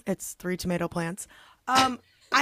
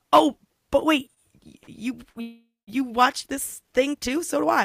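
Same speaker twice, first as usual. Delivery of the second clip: higher, louder gush of delight; imitating excited fan; 4 semitones higher, 4 dB louder than usual. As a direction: play the eager fan who just found a fellow fan. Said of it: "get excited about it".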